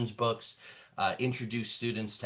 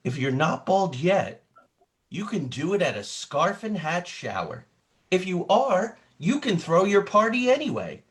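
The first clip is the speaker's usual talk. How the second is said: excited read-aloud, higher and louder at the party invitation; dramatic storybook reading; 6 semitones higher, 6 dB louder than usual. Acted. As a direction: sell the party to a young listener. Reading picture book aloud dramatically.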